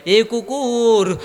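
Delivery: high, drawn-out scolding call; angry master. Acted. Furious master to his dog, high drawn-out call. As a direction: stretch the call out in mild mock anger. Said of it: "মালিক রাগান্বিত হয়ে বলে".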